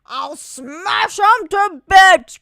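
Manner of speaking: fierce vow. Acted. Warrior vowing to fight.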